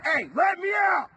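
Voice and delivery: high, slow, loud yell from the cell; retold shout. Locked up and free to leave, shouting at the guards. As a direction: bellow to be let out. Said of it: "You can yell at everyone".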